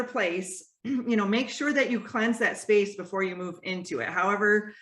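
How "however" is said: ordinary delivery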